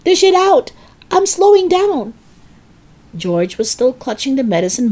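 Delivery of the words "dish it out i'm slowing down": very high, loud, urgent order; demanding yell. A narrator's reading. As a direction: holler it down impatiently. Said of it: "she yelled".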